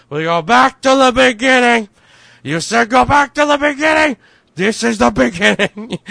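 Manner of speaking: high, loud, grand movie-line delivery; hammed-up epic proclamation; booming film quote in a high voice; dramatic movie quote.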